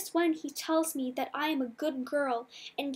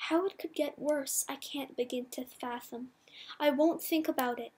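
ordinary delivery

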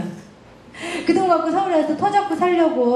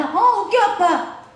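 bright daughter reply; 8 semitones higher, slower than usual. Retold or imitated. Daughter answering father brightly.

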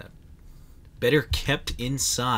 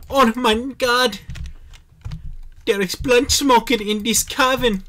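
shocked exclamation; 12 semitones higher, 9 dB louder than usual. Real, not acted. Shocked discovery in game.

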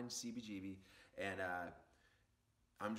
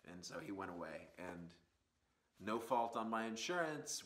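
ordinary talk

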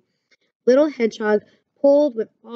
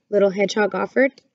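plain reading voice

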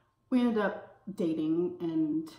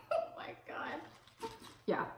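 laughing; 8 semitones higher, 9 dB quieter than usual. Real, not acted. Laughing at the embarrassing twist.

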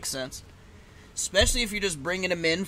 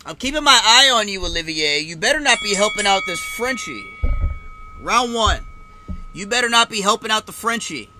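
higher-pitched warning to the referee; raised, emphatic telling-off; mock warning; 5 semitones higher, 6 dB louder than usual. Real, not acted. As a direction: warn the referee from the stands. Jokingly warns the French referee.

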